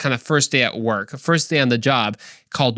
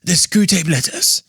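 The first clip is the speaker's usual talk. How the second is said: mock-British narrator, pitched higher; British accent; 4 semitones higher than usual. Shown as a demonstration. Podcaster tries out a voice for the book title, British accent. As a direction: put on a theatrical British accent. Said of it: "do a great British accent".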